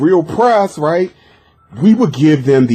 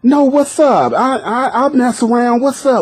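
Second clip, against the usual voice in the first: eager caller; 10 semitones higher than usual. Retold or imitated. Caller undeterred by reveal.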